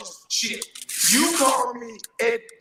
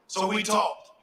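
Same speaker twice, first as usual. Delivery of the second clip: ordinary talk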